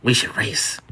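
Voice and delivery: whispery, smiling imitation. Customer to bartender after complete change in energy, smiling whisper. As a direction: whisper it through a smile. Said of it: "Smiling, she says".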